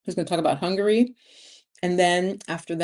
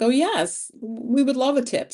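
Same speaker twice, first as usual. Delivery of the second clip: imitating an eager waiter; 7 semitones higher than usual. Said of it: "they're gonna be like".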